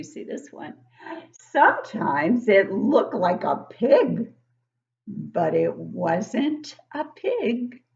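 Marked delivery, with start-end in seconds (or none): 1.5-7.8 slow, drawn-out storybook reading; reading to children. Acted. Teacher reading a picture book to young kids, slow and storybook-like. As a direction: read it slowly to the class, savouring each line.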